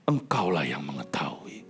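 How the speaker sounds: whispered, slow and hushed. Acted. Prophet answers God in a hushed whisper. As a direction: breathe it to God.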